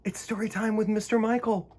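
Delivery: ordinary delivery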